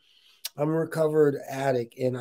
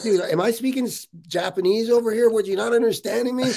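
higher, exasperated snap; irritated retort; 7 semitones higher, 3 dB louder than usual. Retold or imitated. Retelling his irritation at the sponsor's repeated question, higher and exasperated. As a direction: push back like he's not listening. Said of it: "now I'm getting pissed".

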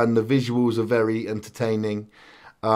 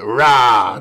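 a short, louder mock roar; animal impression; 2 semitones lower, 5 dB louder than usual. Friend roaring at his co-host as a mock animal, a short hammed-up roar. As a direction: growl it out like a playful beast. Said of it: "Do you like my animal impression?".

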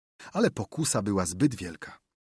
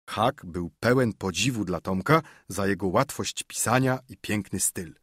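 usual speaking voice

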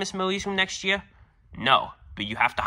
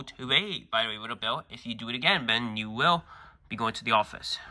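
ordinary talk

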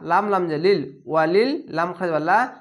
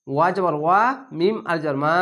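ordinary talk

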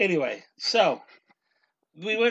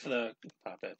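normal talking voice

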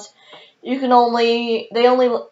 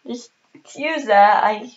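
ordinary talk, nothing marked

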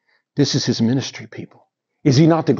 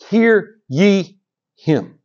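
very slow, weighty voice of God; slow solemn divine command; much slower than usual. Shown as a demonstration. Preacher voicing God from the cloud, slow and solemn. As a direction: let each word fall slowly and heavy. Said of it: "the same thing it said".

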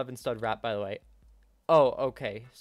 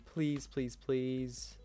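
quiet pleading; 7 dB quieter, much slower than usual. Real, not acted. Begging during a jump.

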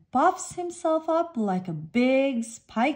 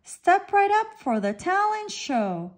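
barker's call; 7 semitones higher than usual. A narrator's reading. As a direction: call the crowd in like a showman. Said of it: "cries Lobster".

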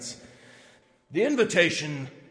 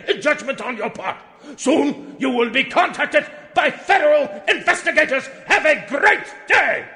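much higher and louder, shouting indignation; furious ranting; 5 semitones higher, 5 dB louder than usual. Acted. Executive ending his letter to the forum, a loud furious rant. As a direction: blow up at them in pompous fury.

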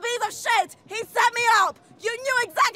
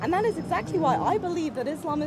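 deep deadpan sarcasm; sarcastic deadpan; 9 semitones lower, 5 dB quieter than usual. Acted. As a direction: play the repentant sinner with a straight face. Mock-submissive prank persona speech.

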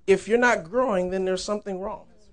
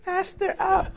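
put-on much higher little-child voice; Nia; 13 semitones higher, much slower than usual. Little girl to pastor while he's preaching, imitated high kid voice. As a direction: mimic a small kid demanding to be picked up. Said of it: "she comes up to pastor".

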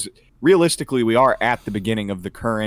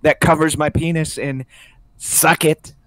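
higher, louder Trump voice; Trump impression; 7 semitones higher, 4 dB louder than usual. Trump explaining his mic hold to critics, Trump voice. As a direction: brag crudely like a rally speech. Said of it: "You're doing it with the mic".

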